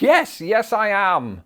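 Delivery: high, loud, drawn-out yes; emphatic confirmation. Retold or imitated. Answering Americans who ask if he is British, hammed-up confirmation. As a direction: play up the proud answer, slowly. His stock answer, acted out.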